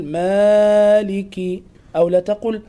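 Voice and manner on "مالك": "مالك" with the a dragged very long; overlong madd. Shown as a demonstration. Teacher warns against this, overstretched a in "مالك". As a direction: stretch the a of "مالك" far too much.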